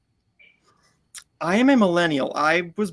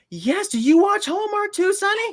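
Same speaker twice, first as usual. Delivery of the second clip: put-on high, eager old lady voice, much higher; excited old lady. Coworker lady asking the young man about Hallmark, high and thrilled. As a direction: squeal it like a thrilled elderly fan. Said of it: "she goes".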